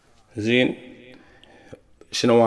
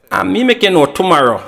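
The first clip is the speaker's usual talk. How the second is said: put-on higher, sweet girl voice; girlfriend's phone voice; 7 semitones higher than usual. Girlfriend putting off her man on the phone, higher girly voice. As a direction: mimic the girlfriend stalling the caller. But you not hear female voice.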